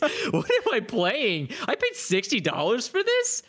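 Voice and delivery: slow, laughing, incredulous rant; laughing disbelief. Retold or imitated. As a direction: laugh through the outrage. Retold outrage at the game.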